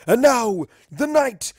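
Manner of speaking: slow, booming menace building to a shout; gloat, then roar the last words; villain's return. Acted. Dark pony on stage threatens the party, slow menace rising to a roar.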